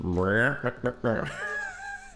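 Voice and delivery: comic trombone wah-wah noise; imitated trombone. Comedian mimics the villain's entrance cue, mock trombone sound. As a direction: voice a big trombone sting for the villain. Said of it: "it's just a big trombone".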